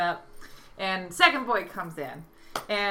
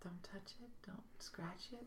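whispered guesses to oneself; musing whisper; 25 dB quieter than usual. Real, not acted. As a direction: think aloud under your breath. Musing over the riddle's answer.

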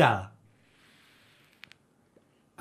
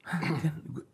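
whisper; 9 dB quieter, slower than usual. Real, not acted. Guest thinks aloud to himself.

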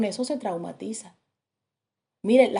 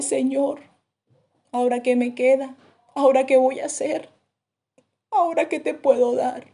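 súplica de rodillas; 4 semitones higher, 4 dB louder than usual. Retold or imitated. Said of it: "se arrodilla y dice".

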